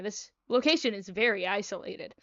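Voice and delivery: usual speaking voice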